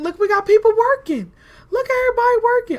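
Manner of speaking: high, loud, rushed delight; excited. Real, not acted. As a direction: squeal it like a proud boss. Prisoners finally get to work.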